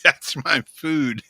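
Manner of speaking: laughing it out slowly; words said through laughter; laughing admission. Retold or imitated. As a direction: confess the stink through a laugh. Laughing while admitting the smell.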